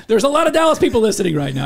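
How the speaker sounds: loud, laughing brag about Dallas; laughing boast. Real, not acted. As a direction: crow about the home crowd, laughing. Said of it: "the greatest city".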